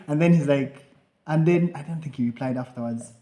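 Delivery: normal talking voice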